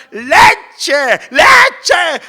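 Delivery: put-on loud, desperate child's yell; imitated tantrum shout; screaming toddler demand. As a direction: bellow it like a hungry little kid. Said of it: "Mis hijos gritaban cuando eran chiquitos".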